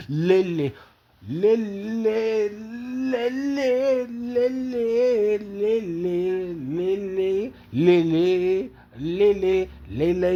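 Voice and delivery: high, drawn-out lovesick crooning of a name; lovesick calling. Pretend. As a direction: pine for Lele, calling her name high. Calling out to his love Lele.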